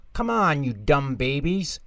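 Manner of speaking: high impatient snap at the babies; impatient cry. A narrator's reading. Angelica impatient with scared babies, high snap. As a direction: push them along, fed up. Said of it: "cried Angelica".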